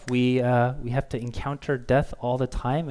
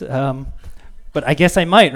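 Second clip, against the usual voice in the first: ordinary talk, nothing marked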